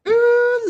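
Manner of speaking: a sudden high squealing ooh; excited squeal. Real, not acted. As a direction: squeal with delight at the drama. Reacting to lovers' fight.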